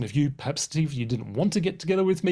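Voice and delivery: ordinary talk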